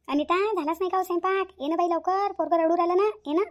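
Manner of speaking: calling out. Acted. Grandmother calls to kitchen.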